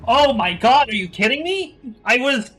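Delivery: high, loud, stunned shout; shocked shout. Real, not acted. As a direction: yell it in total disbelief. Finds hidden illusory wall.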